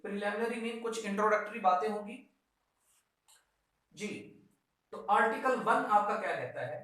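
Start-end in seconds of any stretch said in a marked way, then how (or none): none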